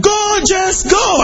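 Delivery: high shouted cheer, joining in. Retold or imitated. Preacher joining the stadium crowd cheering for Jess, high shout. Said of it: "So I said".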